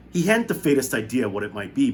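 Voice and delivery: ordinary delivery, nothing marked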